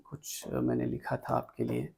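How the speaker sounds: very soft, half-voiced aside; muttering to self. Real, not acted. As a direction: think aloud under your breath while checking. Talking to himself while checking notes.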